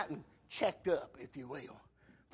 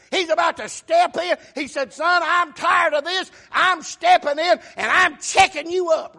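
loud, high preacher's shout voicing God and Paul; full-force preaching; 6 semitones higher, 10 dB louder than usual. Preacher shouting God's challenge to Paul to the congregation, loud and high. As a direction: preach it out at the top of your voice.

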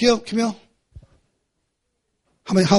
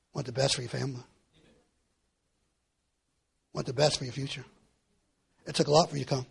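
much softer, lower pastoral voice; tender appeal; 5 semitones lower, 8 dB quieter than usual. Acted. Preacher comforting struggling members, soft and tender. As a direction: speak gently to each listener.

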